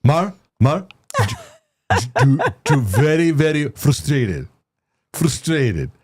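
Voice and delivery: slow, drawn-out mock Spanish accent; Mark's father. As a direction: ham up a Spanish accent, stretching the words. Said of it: "That's Mark's father for everybody".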